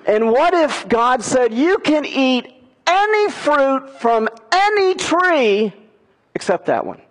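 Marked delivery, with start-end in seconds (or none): 1.5-7.0 generous God voice. Retold or imitated. God telling Adam and Eve, high and generous. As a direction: voice God's open-handed offer.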